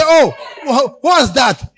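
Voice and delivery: loud startled "Oh, wha-, what's that?"; shocked bystanders. Retold or imitated. Crowd shocked by what falls from his pocket, loud and startled. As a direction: gasp out loud at the dropped item. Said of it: "They say".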